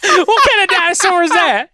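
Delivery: high-pitched, laughing question; cracking up mid-question; helpless laughter. Real, not acted. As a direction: squeal it out through real laughter. Cracking up over a mispronounced word.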